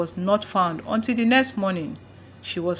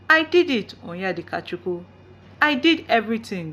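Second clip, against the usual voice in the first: usual speaking voice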